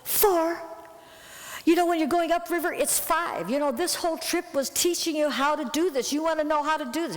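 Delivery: high, pressing mock exasperation; exasperated scolding. Angry retold scolding at crew.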